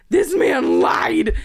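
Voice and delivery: slow, loud, emphatic outrage; loud, drawn-out angry accusation; angry shout. Real, not acted. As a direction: spit out each word at the liar. Ranting about scam seller.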